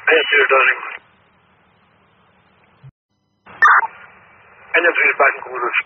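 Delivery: firefighter over the radio; clipped radio call from a truck; urgent firefighter radio. Real, not acted.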